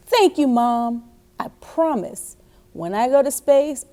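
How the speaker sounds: put-on animated, emphatic reading to children; expressive picture-book narration with loud key words; storybook read-aloud. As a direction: read it out big and encouraging for little listeners.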